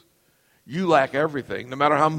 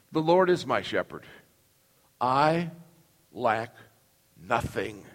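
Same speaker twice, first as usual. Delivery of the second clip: slowing recitation; 6 semitones lower, 4 dB quieter than usual. Acted. Reading psalm line by line.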